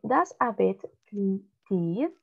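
slow, careful read of "does a vet clean teeth?"; slow model reading. Shown as a demonstration. Teacher reading slowly for Benjamin to repeat. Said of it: "read with me".

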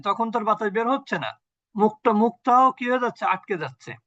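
ordinary talk

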